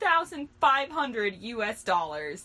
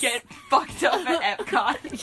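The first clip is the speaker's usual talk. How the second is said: laughing; 4 semitones higher, 8 dB louder than usual. Real, not acted. Laughing rowdy plan.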